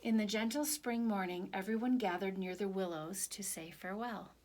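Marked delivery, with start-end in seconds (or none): none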